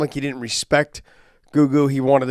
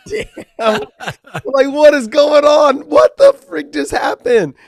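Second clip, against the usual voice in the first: much higher, louder and slower, hammed-up panic; reliving disbelief; 13 semitones higher, 9 dB louder, slower than usual. Co-host reliving his panic watching AJ's fight, high, loud and slowed. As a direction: play up the shock of watching your friend nearly lose.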